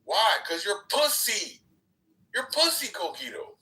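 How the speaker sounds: taunting insult. Real, not acted.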